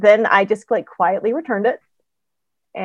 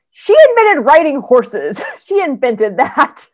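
higher, louder, slow and laughing; laughing with delight about a heroine's inventions; excited gushing; 6 semitones higher, 7 dB louder, slower than usual. Real, not acted. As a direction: marvel at it with delight.

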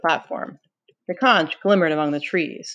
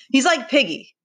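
higher, determined accusation; voice going up; 8 semitones higher than usual. A narrator's reading. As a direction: accuse with the pitch pushed up. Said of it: "Jack's voice went up, tremulous".

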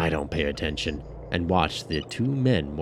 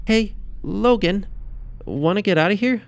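much higher, louder woman's voice; Janet; 4 dB louder than usual. A narrator's reading. Janet asking Logan to leave with her. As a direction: invite him warmly.

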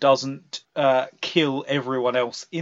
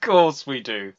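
ordinary delivery

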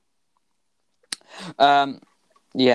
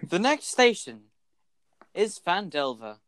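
announcer voice; 6 semitones higher than usual. Acted. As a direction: announce the next stop. Bus announcer voice.

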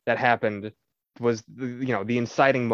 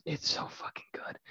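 breathless whisper of pure awe; 15 dB quieter than usual. Real, not acted. Raving to Scott about the game's ending, whispered awe. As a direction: drop to a whisper, overcome with how good it is. Gushing about Conrad's speech.

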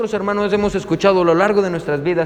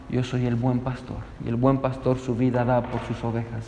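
soft, low-pitched spoken prayer; 6 semitones lower, 6 dB quieter than usual. Real, not acted. Pastor leading the congregation in opening prayer, soft and low. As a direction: pray quietly and humbly to the Father.

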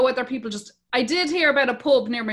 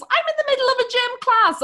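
put-on high, put-upon boy voice; mock teenage son; 13 semitones higher, 4 dB louder than usual. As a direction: answer back like the kid in the middle, much higher. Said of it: "the fella in the middle's".

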